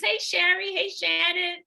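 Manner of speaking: normal talking voice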